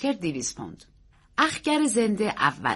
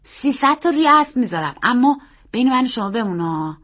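put-on high, loud, earnest child voice; serious little-boy voice, pitched up; 5 semitones higher, 6 dB louder than usual. A narrator's reading. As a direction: play an earnest boy stating a big bet. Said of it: "پسر خیلی جدی گفت".

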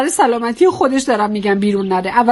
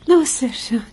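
wife putting on loving politeness; polite, affectionate. Acted. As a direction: call your husband sweetly under your breath. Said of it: "یه بار محترمانه اون حرفو بزنید".

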